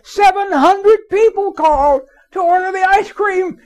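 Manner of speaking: very high, loud, slow, laughing through it; amazed boss, laughing. Retold or imitated. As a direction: laugh in disbelief while announcing. Owner raves about call volume.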